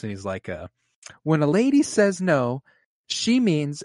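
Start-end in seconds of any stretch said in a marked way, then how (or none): 1.2-3.8 high sassy drag queen voice; lightly voiced drag queen, higher. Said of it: "he strikes up his, his drag voice".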